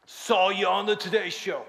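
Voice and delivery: gruff Southern husband impression; Southern accent. Husband explains why they came, Southern accent. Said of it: "That's how he talked".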